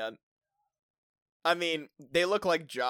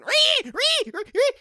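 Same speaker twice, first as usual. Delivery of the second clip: very high, loud repeated pig shrieks; scared piggy squeal; 9 dB louder than usual. Shown as a demonstration. Showing his scared piggy to his co-host, shrieking. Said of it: "That's my scared piggy".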